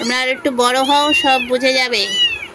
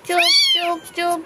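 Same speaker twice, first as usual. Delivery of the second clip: sharp high hush to settle kittens; 4 semitones higher, slower than usual. Real, not acted. Owner hushing noisy kittens, sharp high shush. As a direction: shush them quickly, pitched way up.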